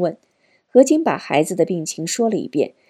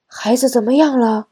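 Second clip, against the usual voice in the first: higher-pitched grandfather asking anxiously on the phone; elderly father's voice; 4 semitones higher than usual. A narrator's reading. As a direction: play the worried old father. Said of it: "是父亲的声音". (老父亲焦急询问的声音)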